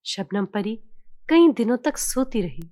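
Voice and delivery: ordinary delivery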